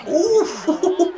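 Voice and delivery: delighted high "ooh" breaking into laughter; high laughing whoop. Real, not acted. Player reacting to a block, laughing whoop. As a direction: whoop and laugh at a big play.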